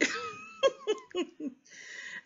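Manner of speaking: very high burst of real laughter; a squealing laugh at the joke; high shrieking laugh. Real, not acted. Laughing at the librarian she just called lazy, high shriek. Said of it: "You lazy".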